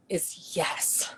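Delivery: dramatic whispered reveal. Acted. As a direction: whisper the answer for suspense. Teasing answer to sing request.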